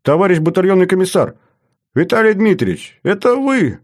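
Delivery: high-pitched, amazed recognition; startled, rising wonder; astonished gasp. Acted. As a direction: exclaim in disbelief as you recognise him. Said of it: "охнул от неожиданности Лерман".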